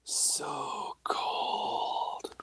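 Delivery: breathy ghost whisper on the recording; ghostly whisper. Retold or imitated. Ghost on the recorder whispering at the caller. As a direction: whisper it like a voice caught on tape. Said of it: "Like this".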